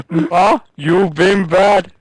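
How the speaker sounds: high, hammed-up Sloth yell; Sloth impression. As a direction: bellow it like Sloth from the Goonies. Imitating Sloth from the Goonies.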